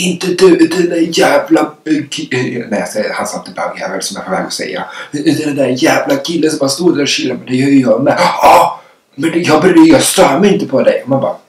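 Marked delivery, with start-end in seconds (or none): 0.0-2.7 high, loud outburst breaking into stumbles; mock-furious yell, then falter searching for the word; retold angry shouting. Angry man in the drive-through correcting himself, retold shouting. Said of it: "började skrika och så bara". 5.1-11.0 high, heated yelling, acted out; retold shouting match. Two people quarrelling over who is chilling, mock shouting. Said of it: "så började vi skrika där".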